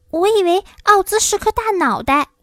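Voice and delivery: put-on high-pitched, disappointed girl; high, puzzled storybook heroine; surprised Dorothy.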